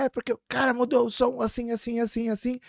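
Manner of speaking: put-on high-pitched retelling of an upset child; mildly acted upset protest; upset, pissed off. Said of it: "Puto, né? Tipo, puto e triste".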